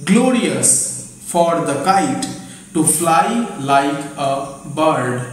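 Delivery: slow, with pauses between words; slow dictated sentence; slow dictation. Shown as a demonstration. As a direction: read it out for note-taking. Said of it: "আবার বলছি".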